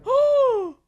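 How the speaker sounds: high, loud startled oh; alarmed cry. Real, not acted. Shock at sudden scene.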